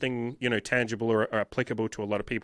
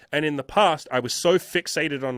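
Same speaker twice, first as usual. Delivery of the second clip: ordinary talk, nothing marked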